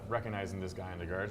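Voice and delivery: usual speaking voice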